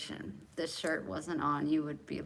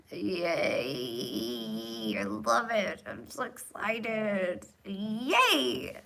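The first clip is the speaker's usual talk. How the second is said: mock cheer; 4 dB louder, much slower than usual. Mock cheer for flea medicine.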